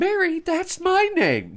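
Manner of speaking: mock deadpan lady voice; deadpan saleswoman. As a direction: say it dead flat, unimpressed.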